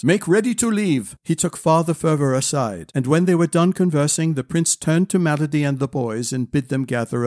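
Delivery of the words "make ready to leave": higher-pitched, contained command; calling out. A narrator's reading. Prince ordering friends to get ready, calling. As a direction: call the order to companions. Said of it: "called Bryant to his friends".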